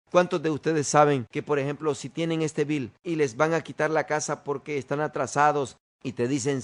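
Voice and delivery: ordinary delivery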